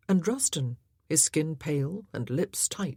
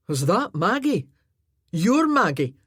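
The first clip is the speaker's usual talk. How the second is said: louder, astonished Highland man; surprised friend; 3 semitones higher, 7 dB louder than usual. A narrator's reading. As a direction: gasp out the recognition. Voices Gavin.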